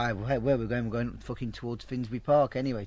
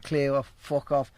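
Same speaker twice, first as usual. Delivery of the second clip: slow send-off at usual pitch; toned-down retold dismissal; slower than usual. Geezer sending caller away as they do, toned-down dismissal. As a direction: dismiss them slowly, without shouting. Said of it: "but you know as they do".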